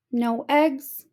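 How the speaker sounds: plain reading voice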